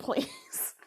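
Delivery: ordinary talk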